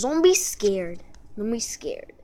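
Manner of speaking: normal talking voice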